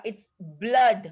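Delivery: "blood" with a short u like "blud", not "blood"; correct pronunciation. Shown as a demonstration. Teacher correcting how pupils say "blood", clear model. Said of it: "अब कोई भी इसको ब्लूड read नहीं करेगा".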